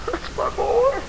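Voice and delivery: very high, strained cry without volume; anguished recognition. Acted. Father recognizing his boy in hell, anguished cry. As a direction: let it out as a small, high cry. Father hears son in torment.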